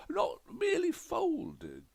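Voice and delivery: very high, loud exclamation; outraged rant. A narrator's reading. Widower rants to the detective, high and loud. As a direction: burst out about the towels. Delamere rants about towel folding.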